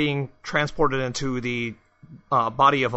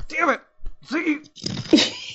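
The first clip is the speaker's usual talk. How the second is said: high exasperated yell around a cigar; Al, cigar in mouth; 12 semitones higher, much slower than usual. Pretend. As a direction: bark at Ziggy with a cigar clenched. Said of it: "hitting the thing with a cigar in my mouth and going".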